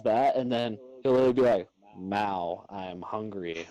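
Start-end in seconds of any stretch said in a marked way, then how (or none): 2.0-3.7 low, soft talking cat; hungry cat voice. Retold or imitated. Cat asking owner for food, low soft plea. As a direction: put on a quiet, low cat voice.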